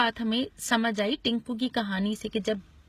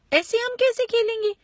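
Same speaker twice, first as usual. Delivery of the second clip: put-on high, loud child's complaint; loud protest; 13 semitones higher, 6 dB louder than usual. Guddu objects to Tinku taking both, loud protest. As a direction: protest at a raised pitch, really exasperated.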